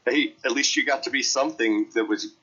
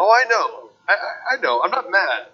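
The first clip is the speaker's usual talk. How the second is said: high, loud "I'm not mad"; defensive; 7 semitones higher, 5 dB louder than usual. Real, not acted. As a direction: protest a little too much. Protesting that he isn't upset.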